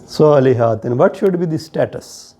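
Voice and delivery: usual speaking voice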